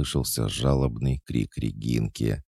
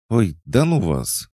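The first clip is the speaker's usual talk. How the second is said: much higher, louder irritated huff; annoyed huff; 10 semitones higher, 5 dB louder than usual. A narrator's reading. Embarrassed Alisa brushes everyone off, annoyed huff. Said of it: "недовольно фыркнула Алиса".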